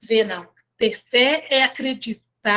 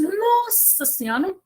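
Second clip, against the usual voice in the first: shocked denial; 6 semitones higher than usual. Retold or imitated. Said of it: "Aí ela falou assim".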